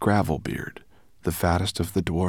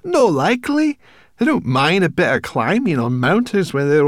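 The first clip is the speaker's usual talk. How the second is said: high, loud, indignant refusal; flustered brownie protest, forceful and very high; alarmed brownie; 11 semitones higher, 10 dB louder than usual. A narrator's reading. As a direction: dig your heels in, voice pitched right up. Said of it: "retreated from the precipice in alarm".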